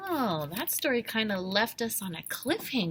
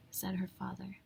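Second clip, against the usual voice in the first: plain reading voice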